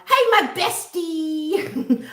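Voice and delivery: high, very drawn-out bestie; long affectionate squeal; excited greeting. Real, not acted. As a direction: stretch it out with delight. Greeting her best friend.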